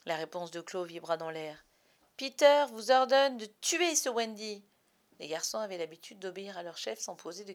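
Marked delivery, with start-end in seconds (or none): 2.2-4.6 loud, high imperious fairy order; commanding fairy. A narrator's reading. Jealous Tink orders the boys, imperious. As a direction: command it spitefully.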